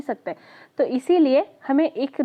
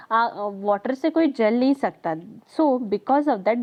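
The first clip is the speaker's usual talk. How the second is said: ordinary talk, nothing marked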